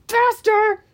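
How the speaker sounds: loud, high-pitched urging; urgent shout. Acted. Hero urges companion down the hill, urgent shout. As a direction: yell it on the run.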